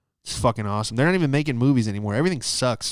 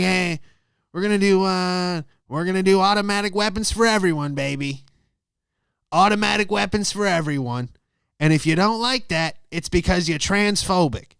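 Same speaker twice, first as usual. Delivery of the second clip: mock politician; 6 semitones higher than usual. Imitating Jenner's campaign.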